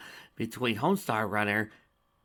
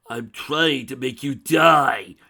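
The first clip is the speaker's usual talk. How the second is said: slow, much louder screeching yell; 4 semitones higher, 13 dB louder, much slower than usual. A narrator's reading. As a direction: screech it right in his face. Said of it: "the dragon screeched".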